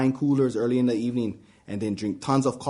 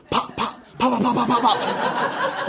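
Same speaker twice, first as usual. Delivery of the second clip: popping bug sounds; 8 semitones higher, slower than usual. Acted. Bugs hitting windshield.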